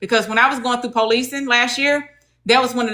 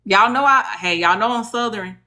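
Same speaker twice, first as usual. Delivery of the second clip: ordinary talk, nothing marked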